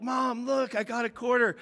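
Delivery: put-on small boy bursting to share news; excited little boy. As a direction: show mom the quarter like a thrilled kid. Voicing himself as excited child.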